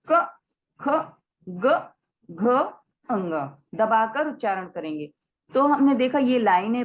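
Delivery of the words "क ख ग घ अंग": क ख ग घ अंग said short and pressed; short pressed sounds. Shown as a demonstration. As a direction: clip each consonant short. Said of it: "short उच्चारण करेंगे". (दबाकर छोटा उच्चारण)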